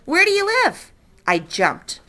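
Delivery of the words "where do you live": much higher sudden question; sudden old lady question. Acted. Old lady suddenly asks the boy, high abrupt question. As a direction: pop the question out of nowhere.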